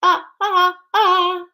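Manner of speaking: high, loud imitated "ah, ah, ah" cries; movie character's cry. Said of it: "there's a part in there where she goes".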